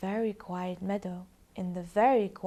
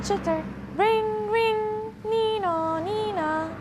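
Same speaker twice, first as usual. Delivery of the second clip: high squeaky chittering noises; fox chatter noises; 13 semitones higher, 5 dB louder, slower than usual. Acted. Narrator making the foxes' noisy sounds, high and squeaky. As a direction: chitter and ring like toy foxes.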